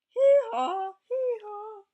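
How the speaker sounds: very high loud braying; donkey bray. Acted.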